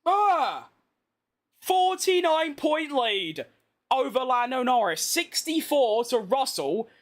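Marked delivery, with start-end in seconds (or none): none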